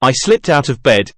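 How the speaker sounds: usual speaking voice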